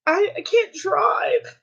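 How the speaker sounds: high tearful regret; real crying. Real, not acted. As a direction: grieve lost independence through tears. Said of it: "you should not cry like I am".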